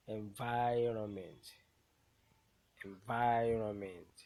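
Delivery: Environment with VI very loud and stretched; teacher's demo of en-VI-ronment; stressed syllable. Shown as a demonstration. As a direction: slowly swell on VI in Environment, then fall. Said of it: "the one that will be pronounced louder".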